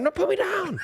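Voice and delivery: high panicked yelp; panicked shout. Retold or imitated. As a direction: yelp it like you're being lifted. Retold kid lifted, protesting.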